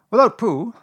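loud, high, weighty pronouncement; solemn Rabbit. A narrator's reading. Rabbit pronouncing on Pooh's importance, solemn. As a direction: declare it importantly and loudly. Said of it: "said Rabbit solemnly".

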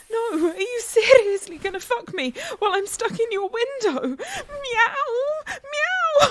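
protest, then break into high meows; building excited protest. Acted. Catgirl stuck in a window, protesting then meowing at her partner.

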